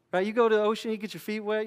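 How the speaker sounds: ordinary delivery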